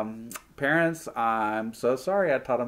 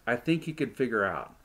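ordinary delivery

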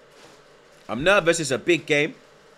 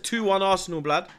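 higher, proud and bold; say it with chest; 6 semitones higher than usual. Shown as a demonstration. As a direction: declare it like a chant from the stands. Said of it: "Say it with chest".

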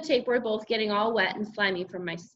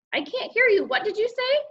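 very high, louder questioning voice; mother calling; 13 semitones higher, 4 dB louder than usual. A narrator's reading. Mother who can't hear her son, calling. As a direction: call through a shut door, pitched up. Mother calls through the door.

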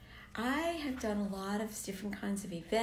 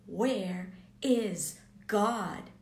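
slow, louder mock scream of "Where is God?"; retold scream; 7 dB louder, much slower than usual. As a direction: drag out a half-shouted cry, imitating him. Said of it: "he started screaming".

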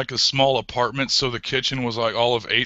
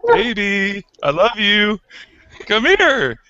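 higher, syrupy, falsely loving; mock sweet husband; 7 semitones higher, 4 dB louder, slower than usual. Husband luring his wife into a hug, falsely sweet. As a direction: lure her in sweetly for a hug. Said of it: "And I said".

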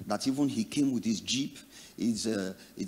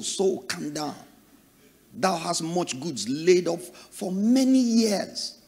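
soothing his soul; 6 semitones higher, 3 dB louder, slower than usual. Acted. Rich man soothing his soul.

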